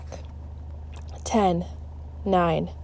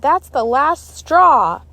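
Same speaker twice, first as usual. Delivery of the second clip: slow angry shout; 7 semitones higher, 6 dB louder, slower than usual. A narrator's reading. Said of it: "she shouted".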